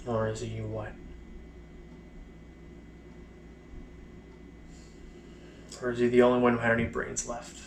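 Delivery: halting, bitter recollection, long silences between phrases; mostly subdued, dragging each painful fragment out; letting out repressed emotion. Acted. Blinded soldier recalling the trench, letting out repressed bitterness haltingly. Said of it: "letting out some, some negative emotion".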